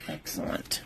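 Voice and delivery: quiet whispered praise; whispered verdict. Real, not acted. Reviewer whispering approval of the notepad, whispered. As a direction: breathe the word out, pleased.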